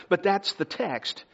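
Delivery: ordinary talk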